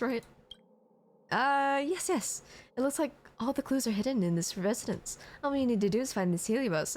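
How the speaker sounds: normal talking voice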